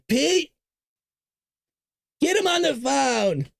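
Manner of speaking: high, slow bossy mock president; Trump impression. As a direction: order the secretary around, Trump-style. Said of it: "He says".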